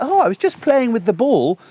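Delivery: lightly mocking a naive owner's excuse; mock careless owner. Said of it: "You're like".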